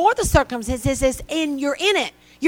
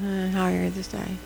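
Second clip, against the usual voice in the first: mock half-hearted greeting, much lower and much softer; half-grin greeting; 4 semitones lower, 8 dB quieter than usual. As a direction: mumble it through a forced half smile. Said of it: "you can give a half grin".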